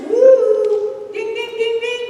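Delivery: mock alarm bell. Buzzer sound for wrong attitude.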